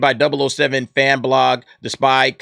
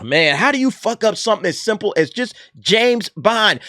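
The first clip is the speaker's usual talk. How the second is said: ordinary delivery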